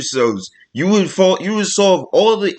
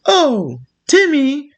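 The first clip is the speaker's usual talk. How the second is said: much higher, sing-song mock scolder; mock prim voice; 11 semitones higher, much slower than usual. Speaker mocking a softened way of saying it, high mock prim voice. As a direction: put on a high, fussy voice.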